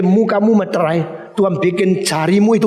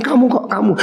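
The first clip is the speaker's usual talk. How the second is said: high, half-laughing "you, aren't you?"; pointing him out, high and amused; incredulous accusation; 6 semitones higher than usual. Retold or imitated. Confronting the con man in lift.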